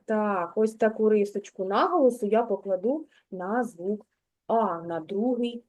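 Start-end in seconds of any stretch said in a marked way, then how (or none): none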